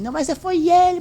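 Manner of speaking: high, loud blame-shifting whine; loud, high mimicked objection; mock protest. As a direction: imitate someone protesting, pitch shooting up. Mimicking someone blaming the partner.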